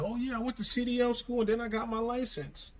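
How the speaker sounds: high-pitched mocking imitation; put-on high voice, lightly mocking; imitating breezy YouTubers. Imitating casual YouTubers' boasts.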